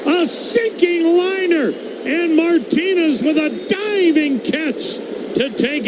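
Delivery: high, still-thrilled replay description; excited recap. Real, not acted. Recapping the diving catch.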